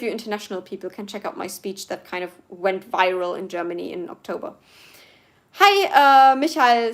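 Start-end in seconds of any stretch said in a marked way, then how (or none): 5.5-6.9 a bright, loud, high hello; excited hi. Real, not acted. Streamer loudly greets a viewer in chat, excited. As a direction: call out a delighted greeting.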